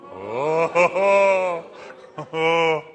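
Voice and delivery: loud startled oh's while losing balance; mock panic cries on the rope; wobbly gasps. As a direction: gasp like you're tipping off a tightrope. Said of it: "wobbling on pretend tightrope".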